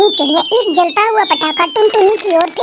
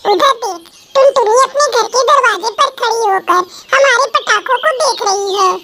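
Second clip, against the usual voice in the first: mocking rich girl. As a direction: look down on the poor neighbour. Rich girl mocks poor girl. (अमीर लड़की की ताने भरी ऊँची आवाज़)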